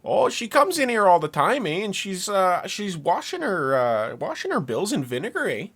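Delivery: exaggerated Canadian lilt with rising eh; Canadian accent. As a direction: imitate a gossiping Canadian coworker. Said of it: "he's this proper Canadian man".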